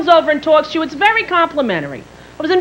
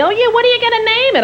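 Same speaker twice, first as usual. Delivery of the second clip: high-pitched mock lady voice; pry in a high put-on voice; nosy stranger; 10 semitones higher than usual. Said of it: "she's kept asking me".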